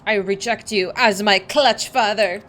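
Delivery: loud, slow, defiant son; angry young Sahagin. Acted. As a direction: spit out the rejection with fury. Plays Sahagin son character.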